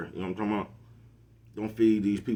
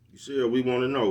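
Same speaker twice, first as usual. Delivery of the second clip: ordinary delivery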